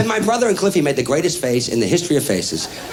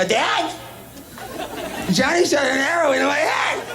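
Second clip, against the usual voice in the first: high, plaintive little-boy impression; wailing kid tattling; 8 semitones higher, 3 dB quieter than usual. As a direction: whine it up high to Dad. Hurt child tells his dad.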